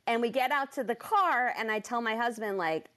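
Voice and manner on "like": ordinary talk, nothing marked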